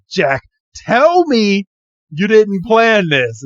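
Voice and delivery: mock-dramatic disbelief; dramatic accusation. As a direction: drag it out like a betrayed friend. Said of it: "And he's like".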